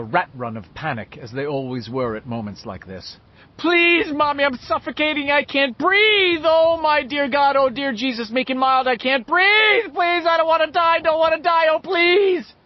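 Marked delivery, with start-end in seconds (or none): 3.6-12.5 high, loud, desperate gasping plea; panicked pleading. Acted. Boy in an asthma attack begging his mother, panicked high cries. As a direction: beg for air in total panic.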